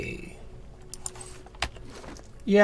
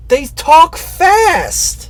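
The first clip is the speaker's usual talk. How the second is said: high, drawn-out shout of self-defence; indignant raised-voice excuse; loud protest; 10 semitones higher, 9 dB louder, much slower than usual. Real, not acted. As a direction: yell it back, stretching the words out high. Protests when told off for speed reading.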